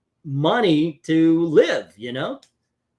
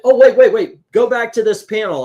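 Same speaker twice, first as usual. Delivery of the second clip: sudden loud, high burst; excited, raised-voice halt; urgent interruption; 8 semitones higher, 10 dB louder than usual. Real, not acted. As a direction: cut yourself off and call them back urgently. Host interrupts himself, calls back.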